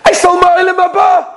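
high, crowing announcement; acted giddy husband, pitched high; gleeful boast. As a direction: blurt it out like a great joke.

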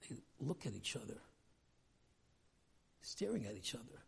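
normal talking voice

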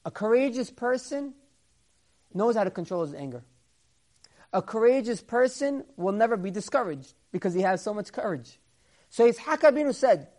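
normal talking voice

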